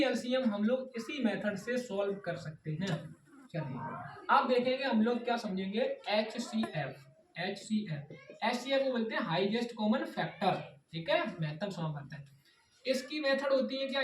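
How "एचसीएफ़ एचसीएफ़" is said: drawn-out term. Shown as a demonstration. Teacher introducing the new term.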